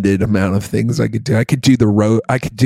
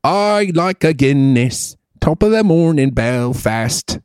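put-on jovial Irish accent; 4 semitones higher than usual. Host riffing as his jovial Irishman, Irish accent. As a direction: play a jovial Irishman, stretching the vowels. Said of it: "your Irish guy is, like, the jovial guy".

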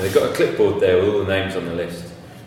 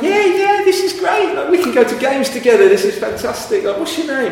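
high, excited gushing; over-eager greeting; 5 dB louder than usual. Retold or imitated. Palace fan thrilled to meet another one, over-eager. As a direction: gush loudly and high-pitched, like an instant best mate.

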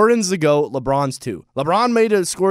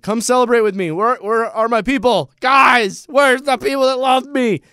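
calling people over; 6 semitones higher, 3 dB louder than usual. Retold or imitated. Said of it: "He's like".

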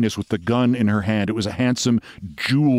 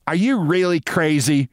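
raised, incredulous demand; higher, pressing question; 7 semitones higher than usual. A narrator's reading. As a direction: demand an answer, pitch lifted. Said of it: "she demanded".

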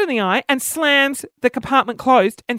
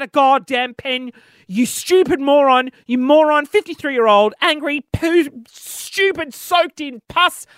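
exasperated, emphatic name-calling; frustrated rant; 3 semitones higher, 4 dB louder, slower than usual. Real, not acted. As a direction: pile up insults in heated frustration. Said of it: "how frustrated I am right now".

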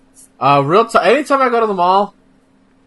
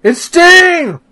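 high, loud excited shout; 12 semitones higher, 6 dB louder than usual. Real, not acted. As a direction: call it out with delight. Excited at seeing [name].

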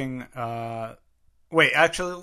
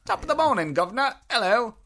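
high-pitched cartoon British greeting; exaggerated high Cockney-style British; over-the-top British accent; 9 semitones higher than usual. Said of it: "Can everyone do a British accent?".

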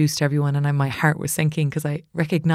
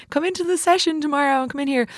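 high, eager voice; eager invite; 10 semitones higher than usual. Retold or imitated. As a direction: invite her in, high and keen. Others inviting her eagerly.

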